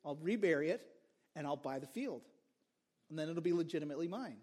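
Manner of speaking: a man plotting to himself, low-key; scheming plan. Retold or imitated. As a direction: hatch the plan under your breath. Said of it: "He goes".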